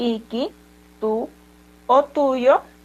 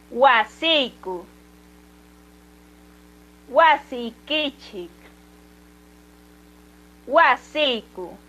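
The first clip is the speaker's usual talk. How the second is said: each word drawn out slowly for students to repeat; slow model reading; much slower than usual. Shown as a demonstration. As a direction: say every form slowly so the class can echo it. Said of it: "voy a volver a repetir estas palabras". (lectura lenta y clara para que repitan)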